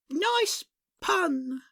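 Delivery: high, slow, pleased beaver; a beaver accepting a compliment. Acted. Beaver replies to the customer, high and slow.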